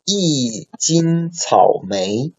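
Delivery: "一斤草莓" said very slowly, 一 on a falling fourth tone; slow tone demo. Shown as a demonstration. Teacher reads the last example to the class, very slow. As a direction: model "一斤草莓" syllable by syllable. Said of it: "first tone".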